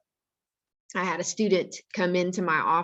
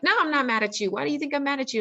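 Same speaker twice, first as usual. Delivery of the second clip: imitated indignant protest, higher pitched; retold angry yelling; 8 semitones higher, much faster than usual. Upset student denying it to principal, high mock yelling. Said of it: "he started yelling again".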